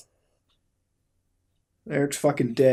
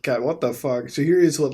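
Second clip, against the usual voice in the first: normal talking voice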